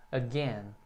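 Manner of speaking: "Again" with a brief "uh" before GAIN; short first vowel. Shown as a demonstration. Teacher models the word for students, "Again" with a very short A. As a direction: keep the A of "Again" tiny, stress the second syllable. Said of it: "o A ele é bem curtinho".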